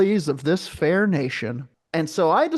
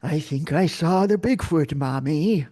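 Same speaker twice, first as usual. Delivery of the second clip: halting presidential impression, drawn-out opening; Ronald Reagan. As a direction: put on the ex-president's voice, pausing after the first word. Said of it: "that's Ronald Reagan, guys".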